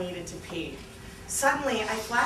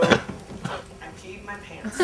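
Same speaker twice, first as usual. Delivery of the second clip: plain reading voice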